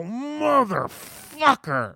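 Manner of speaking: drawn-out, high-pitched agonized curse; pained outburst. Retold or imitated. Customer after a kid stomps his toe, pained outburst. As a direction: stretch it out in a high howl of pain. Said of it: "I punch the table and go".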